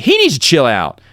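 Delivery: indignant retort climbing high at the end; retold anger. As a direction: throw the blame back, re-enacting outrage. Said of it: "so mad".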